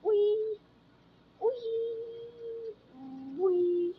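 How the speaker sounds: loud high comic yelps of pain; hammed-up cartoon ouch. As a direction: yelp like you just got hit. Yelping when hit.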